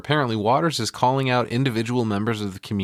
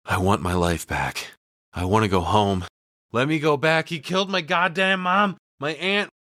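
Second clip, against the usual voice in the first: mild mock plea; 8 semitones higher than usual. Winnie begging the sky.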